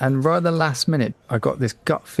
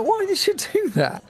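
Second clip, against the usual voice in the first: put-on childlike voice. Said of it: "I'm like".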